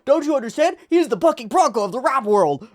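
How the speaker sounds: high, loud, hammed-up explaining; frantic exposition guy. Guy getting kicked around while explaining, frantic and high. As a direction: imitate an exposition character shouting through a beating. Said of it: "this guy just gives us exposition".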